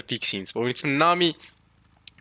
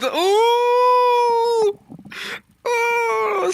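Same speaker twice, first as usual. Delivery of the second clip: very high, loud excited hoot; hyped ooh; 6 dB louder than usual. Real, not acted. Reacting to Scopper knowing the world, excited high ooh. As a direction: howl with hype at the reveal.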